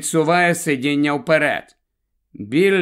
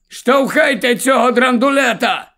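higher, louder yelled order; shouting; 10 semitones higher, 6 dB louder than usual. A narrator's reading. Fat Pete yells to push the old car, shouting. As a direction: drive the men to push harder. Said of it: "кричить товстий Піт".

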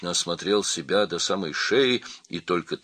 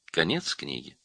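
ordinary delivery

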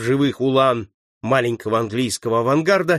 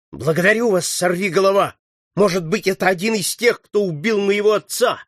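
raised pitch, impassioned thanks; fervent exclamation; 7 semitones higher, 3 dB louder than usual. A narrator's reading. Boy thanks his comrade for avenging his father, exclaiming. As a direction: cry out your gratitude. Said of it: "воскликнул Поль Поттер".

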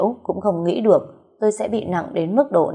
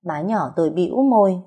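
ordinary delivery

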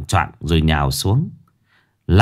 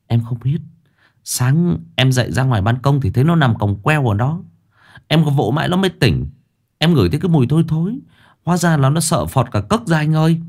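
higher-pitched, quickening junior sidekick voice; Sáu Bưởi's worried report; 6 semitones higher than usual. Acted. Sáu Bưởi telling his boss how he found Hoàng Anh, higher sidekick voice. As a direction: report anxiously in a raised pitch.

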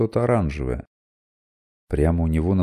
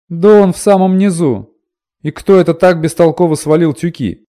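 annoyed woman; 11 semitones higher, 11 dB louder than usual. Acted. Woman complains about sacks.

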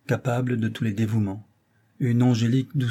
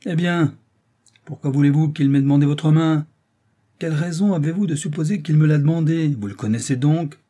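angry interrogation; 6 semitones higher, 6 dB louder than usual. Acted. Father interrogating his daughter angrily.

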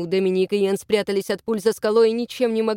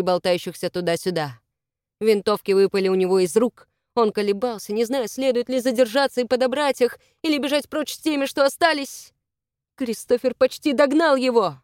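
ordinary delivery

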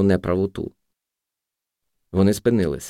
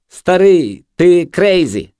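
high, loud mocking cry; loud high-pitched taunt; jeering junkie; 9 semitones higher, 6 dB louder, much slower than usual. A narrator's reading. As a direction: hoot it at the older man. Junkie keeps jeering in the story.

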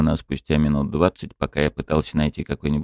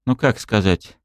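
shivering with teeth chattering; 6 semitones higher than usual. A narrator's reading. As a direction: speak through chattering teeth. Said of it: "стуча зубами, произнесла она".

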